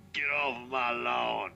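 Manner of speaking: mock-shoo kids away like a crotchety homeowner; crotchety old guy. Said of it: "some crotchety old guy walks out and goes".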